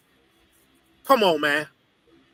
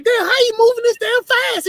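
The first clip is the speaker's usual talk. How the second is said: high, incredulous question; amazed disbelief; 12 semitones higher than usual. Retold or imitated. To the driver, asking how he moves so fast, in high amazed disbelief. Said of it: "I'm like".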